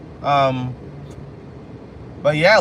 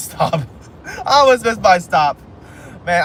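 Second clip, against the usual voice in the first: usual speaking voice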